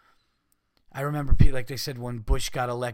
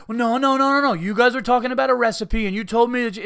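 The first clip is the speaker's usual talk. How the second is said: much higher, louder fumbling denial; mock flustered excuse; 10 semitones higher, 9 dB louder than usual. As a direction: ham up a caught-out fib. Acted phone excuse.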